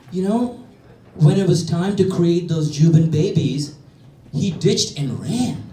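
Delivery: dramatic woman. Retold or imitated. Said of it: "woman tells her story".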